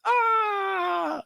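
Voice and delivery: high, long strained cry; overdose gasp. Retold or imitated. Taryn overdosing, long high strained cry. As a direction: cry out as the drug hits. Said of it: "She's like".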